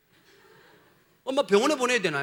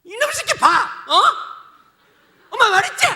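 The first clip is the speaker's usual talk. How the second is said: angry mother yelling; 11 semitones higher, 10 dB louder than usual. Retold or imitated. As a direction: scream at the kid who disobeyed.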